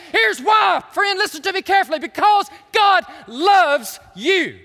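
loud, high, impassioned proclamation; full-force preaching. Real, not acted. Preacher telling friends why God gives rules, full-force preaching. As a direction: drive it home to the congregation at full volume.

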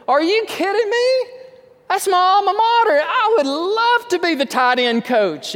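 a coach overjoyed at his dream job; thrilled disbelief. Retold or imitated. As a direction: burst with joy at the offer. Dabo voiced thrilled at job offer.

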